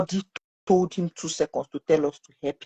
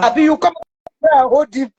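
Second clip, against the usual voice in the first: loud, much higher challenge; retold angry retort; 9 semitones higher, 6 dB louder than usual. Sister to those not holding the boy, retold angry retort. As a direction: throw it back at the bystanders.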